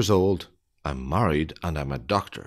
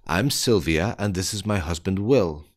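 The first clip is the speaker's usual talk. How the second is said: ordinary delivery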